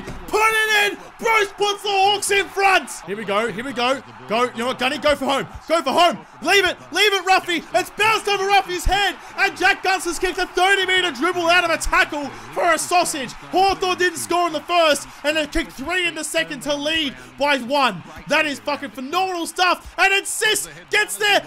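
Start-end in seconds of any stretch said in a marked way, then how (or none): 0.0-2.9 high, loud, slowed goal call like a TV broadcaster; hammed-up sports commentary; mock commentator call. 7.7-16.7 higher, broadcaster-style running commentary; lightly hammed sports broadcast; mock play-by-play.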